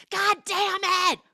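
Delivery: high, drawn-out angry yell; mock furious outburst. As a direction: explode as if punching a wall, hammed up. Retold man punching the door frame.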